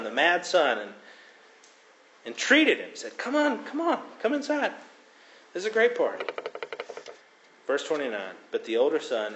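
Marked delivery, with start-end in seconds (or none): 3.2-6.2 high, pleading father's invitation; father coaxing his angry son inside. Acted. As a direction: coax him in gently, much higher.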